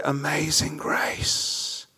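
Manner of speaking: very soft, slow whisper; awed whisper. Acted. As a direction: drop to a hushed, drawn-out whisper of wonder. Hushed response after the climax.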